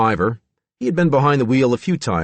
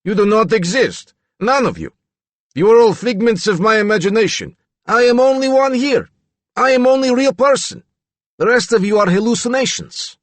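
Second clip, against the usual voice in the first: mock Yugoslavian accent, much higher and louder, growled; mocking Reagan's Yugoslavian accent; 12 semitones higher, 5 dB louder than usual. Steve taunting the others, loud mocking Yugoslavian accent. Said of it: "mocking Reagan's accent".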